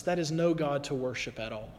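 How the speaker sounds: normal talking voice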